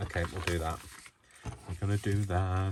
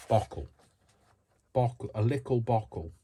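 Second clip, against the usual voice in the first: a hint of a Blackpool accent, bottle said as bockle with a glottal k; 5 semitones higher, 4 dB louder than usual. Crafting aloud, mock Blackpool accent on bockle. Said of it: "murdering people's accents".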